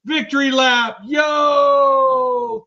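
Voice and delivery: high, loud holler with a long held yo; hype shout-out. Real, not acted. Host hails a viewer in the chat, hype shout-out. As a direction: holler it and hang on the last word.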